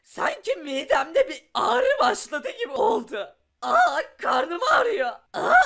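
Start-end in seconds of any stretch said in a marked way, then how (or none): 3.6-5.5 slow, groaning cry of pain; acted stomach pain. Young player after the potion, groaning in pain. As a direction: clutch the belly and wail.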